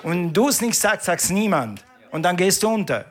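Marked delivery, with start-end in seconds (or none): none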